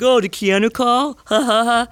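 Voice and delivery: high imitation of jeering schoolmates; taunt, then a fake ha-ha laugh; mocking teasing. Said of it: "like, teasing me".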